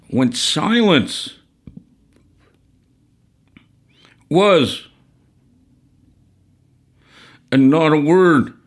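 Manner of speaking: storytelling recitation. A narrator's reading. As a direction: intone it like an elder telling legend.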